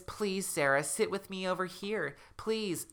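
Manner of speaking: high, softish ghostly plea; pleading ghost. A narrator's reading. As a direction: beg like a lonely ghost.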